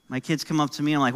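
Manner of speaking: normal talking voice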